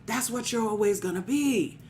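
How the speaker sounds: rising, rhythmic preaching with call-outs; a pastor rallying the congregation. Acted. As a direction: preach it and build to the peak lines.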